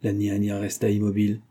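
usual speaking voice

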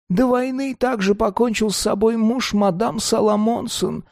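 put-on high old woman, worked up; agitated grandma. A narrator's reading. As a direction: tell the news anxiously like grandma. Grandma keeps talking.

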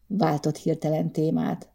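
plain reading voice

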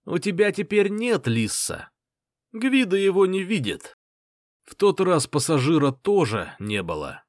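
high, gloating jeer; gloating taunt. Acted. Bully taunts hero.

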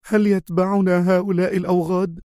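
high, anxious question; tense Khaled. A narrator's reading. Khaled asks Adham about pursuers, tense and high. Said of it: "تمتم خالد في توتر".

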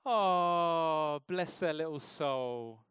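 sugary "bless them", drawn out slowly; mock sweet pity. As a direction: gush with fake sympathy. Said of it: "Have you ever said".

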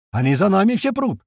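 high-pitched angry call. A narrator's reading. Gunner warning that all are following, angry call. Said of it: "слышим злой голос Колючего".

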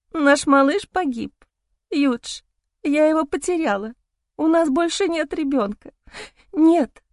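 high, broken grieving wife; anguished whisper. Acted. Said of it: "Она шепчет приглушённо".

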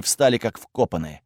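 ordinary delivery

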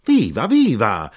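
loud, drawn-out high cheers; shouting. A narrator's reading. Knife-grinder cheering on and on, shouting. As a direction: cheer it twice, long and loud. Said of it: "l'arrotino gridò".

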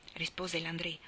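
plain reading voice

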